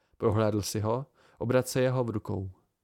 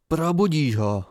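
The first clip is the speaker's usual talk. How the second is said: loud, higher, near-furious command; almost furious; 4 semitones higher, 7 dB louder than usual. A narrator's reading. Bast orders the chronicler to wake him, almost furiously. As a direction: press it out hard, close to fury. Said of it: "Poslední slova pronesl skoro zuřivě".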